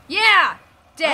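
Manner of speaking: loud, high victory yell; loud excited victory shout; triumphant cheer. Real, not acted. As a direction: whoop at the kill. Kills enemy, cheers.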